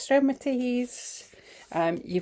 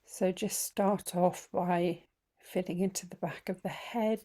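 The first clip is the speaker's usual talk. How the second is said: guided meditation; 5 dB quieter than usual. Acted. Teacher easing the group into feeling the body, guided meditation. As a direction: guide them gently through it, phrase by unhurried phrase.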